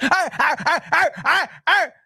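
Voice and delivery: repeated barking "arr" noises; mock yapping; mocking mouth noises. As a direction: imitate her as a string of barks. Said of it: "when she try to open her mouth".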